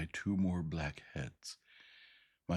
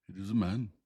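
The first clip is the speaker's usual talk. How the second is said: louder, higher beastly voice; thick creature voice; 5 semitones higher, 8 dB louder than usual. A narrator's reading. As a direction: intone it from the darkness. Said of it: "the voice repeated".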